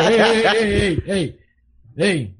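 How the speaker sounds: teasing imitation, laughing through it; Aaron. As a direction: lightly mimic a friend's repeated call, amused. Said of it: "that's how you sound, uh, Aaron".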